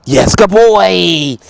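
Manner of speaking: slow drawn-out praise for the dog; praising the dog. Real, not acted. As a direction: stretch out the praise, pleased.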